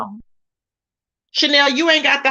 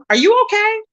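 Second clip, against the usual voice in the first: pitched way up in disbelief; incredulous; 9 semitones higher than usual. Retold or imitated. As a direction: ask it like he has lost his mind. Incredulous quote of him.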